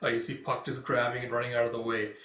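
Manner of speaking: plain reading voice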